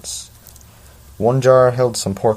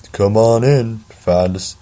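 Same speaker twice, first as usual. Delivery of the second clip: louder half-hearted Southern welcome; failed Southern try; 2 semitones higher, 5 dB louder than usual. Acted. Teacher welcoming students, a botched Southern accent. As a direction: try a Southern teacher voice, not quite landing. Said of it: "no, that doesn't work either".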